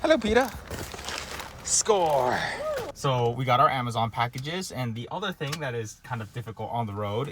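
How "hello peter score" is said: high, loud happy hello; excited greeting. Real, not acted. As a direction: cheer at a delivery. Amazon delivery arrives.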